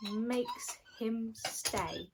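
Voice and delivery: usual speaking voice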